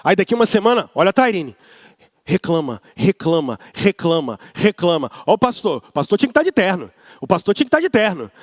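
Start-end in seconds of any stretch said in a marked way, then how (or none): 2.2-5.1 low, droning mock complainer; grumbling mimicry. Shown as a demonstration. Pastor showing the congregation what grumbling sounds like, low droning mimicry. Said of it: "pessoa que fica o tempo todo assim". 5.3-8.3 fast, low muttering gossip voice; mimicking gossip. Pastor imitating members who judge his clothes, fast mock gossip.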